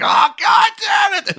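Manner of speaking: hammed-up screaming fit of rage; furious shout. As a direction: blow up as if the scheme just collapsed. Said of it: "he's just like".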